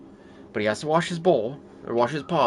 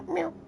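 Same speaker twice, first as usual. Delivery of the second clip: much higher squeaky mock meow; imitated meow; 4 dB quieter than usual. Owner mimics the cat's little meow, high and squeaky.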